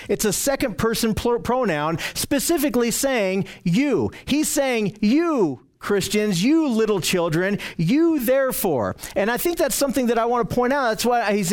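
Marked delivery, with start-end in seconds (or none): 5.0-9.0 "You" drawn out slowly at the head of each phrase; slow, pointed stress on "you"; emphatic you. Retold or imitated. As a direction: lean long and hard on every "you". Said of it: "it's an emphatic you".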